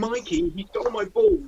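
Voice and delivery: high whiny tattling; whiny high complaint. Retold or imitated. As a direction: whine it up high like a kid. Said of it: "him turning around and going".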